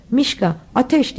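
ordinary delivery

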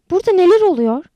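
loud, high demanding question; alarmed question. A narrator's reading. Anita from the doorway to the girls, alarmed. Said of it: "diye sordu Anita kapıdan".

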